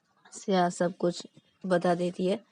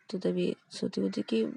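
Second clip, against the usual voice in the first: plain reading voice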